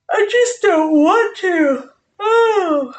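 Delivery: high, slow, tearful whimper; mock whine. Streamer whining to viewers about lumberjacks, mock tearful. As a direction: whimper it like a sulking child.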